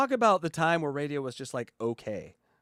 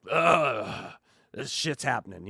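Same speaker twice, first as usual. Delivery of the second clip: weary groan; 4 semitones lower, slower than usual. Retold or imitated. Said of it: "by the tone".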